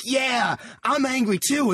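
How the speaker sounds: high fake anger; mock angry. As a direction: pretend to share their rage. Mock joining angry drunks.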